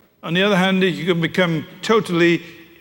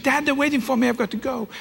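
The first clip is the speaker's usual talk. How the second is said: put-on higher, pleading teen whine fading away; impatient teenage daughter; 7 semitones higher, 4 dB quieter than usual. Daughter begs dad to let her go to friends waiting, higher. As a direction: tug at dad's sleeve to leave.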